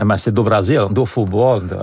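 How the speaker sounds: playful put-on Brazilian Portuguese accent; a radio host briefly jokes in mock Portuguese with the newsreader. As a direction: toss off a couple of words in a light Brazilian accent, for fun. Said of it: "vous êtes bilingue en portugais".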